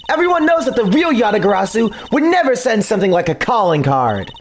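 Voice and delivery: usual speaking voice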